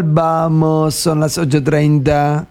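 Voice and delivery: slow, drawn-out sensual delivery. Shown as a demonstration. Host shows the AI how, slow and sensual. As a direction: stretch it out slowly and seductively. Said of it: "Más sensual, más sensual".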